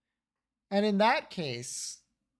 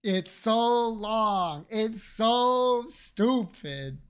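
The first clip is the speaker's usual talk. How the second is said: drawn out; 3 semitones higher, much slower than usual. Real, not acted. Complaining about the last case.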